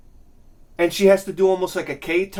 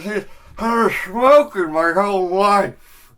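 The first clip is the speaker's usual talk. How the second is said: slurred, slow jawless speech; man missing lower jaw. Acted. Smoker in a TV ad with no jaw, slurred impression. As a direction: talk as if the lower jaw were gone. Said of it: "he's missing his lower jaw".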